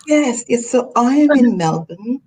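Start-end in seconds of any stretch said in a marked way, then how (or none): none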